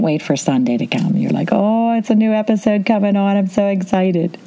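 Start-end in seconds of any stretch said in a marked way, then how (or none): 1.5-4.4 higher, sing-out thrill at good news; mock excitement. TV fan awaiting a new episode, mock excited. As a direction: gush like an eager fan, hammed up. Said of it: "when you're so excited".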